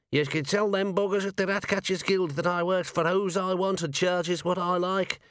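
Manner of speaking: high, scrappy gnome voice; tough little gnome voice. Acted. Gnome kicking an enforcer, scrappy high voice. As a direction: snarl it out like a tiny brawler. Gnome ratcatcher yelling between kicks.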